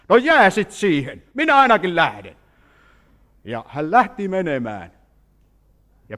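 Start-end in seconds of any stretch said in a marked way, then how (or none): 0.1-2.4 higher, louder exasperated mom; mother giving up and walking away; imitating a worn-out mother telling her son she is leaving; fed-up mother. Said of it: "ja sanoi, että".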